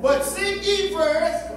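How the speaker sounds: loud, high, slow, fussing call; fussing at home. Shown as a demonstration. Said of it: "say it like you at the house on fussing".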